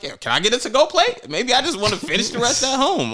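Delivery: high, half-laughing ask; sheepish mock request. Asking at the birthday dinner for a to-go plate, sheepish.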